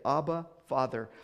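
ordinary talk

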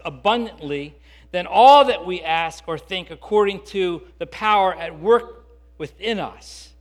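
loud, building preacher's cadence; rising fervent sermon build to the peak; preaching at full force. Acted. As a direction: drive each clause home harder.

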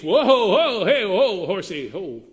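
loud, high "whoa" shouted to stop a horse; calling to a horse. Pretend. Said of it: "Not that".

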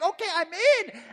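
high, loud, eager agreement; eager yes. Acted. Mary agreeing to God's plan, high and eager. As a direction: jump in happily.

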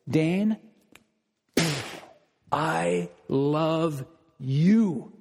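very slow, drawn-out and tender; tender love. Pretend. God telling Dane he loves him, slow and tender. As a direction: linger on every word like a promise.

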